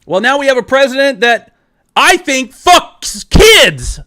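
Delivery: loud, slow, bitter shout; angry yelling. Real, not acted. Telling David about the new president, angry loud yelling. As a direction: spit the words out in disgust. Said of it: "I'm not gonna apologize for yelling, shouting".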